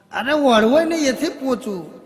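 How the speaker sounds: high, loud angry correction; losing temper. Acted. Husband scolding his wife over the dish, angry and loud. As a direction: snap at her, fed up.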